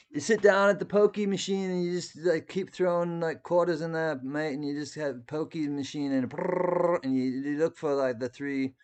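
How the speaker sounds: high, loud, badly faked Australian accent. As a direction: ham up an Aussie mate voice. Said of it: "That was a terrible accent".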